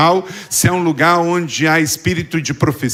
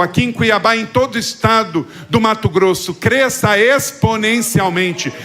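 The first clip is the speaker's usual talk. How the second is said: higher-pitched, insistent pastoral prayer; declaring in prayer to a congregation; fervent prayer; 7 semitones higher than usual. Real, not acted. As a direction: pray with fervour, pitched up, phrase after driving phrase. Pastor leads fervent closing prayer.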